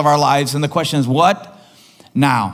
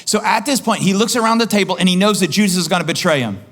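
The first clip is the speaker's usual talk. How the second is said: ordinary talk, nothing marked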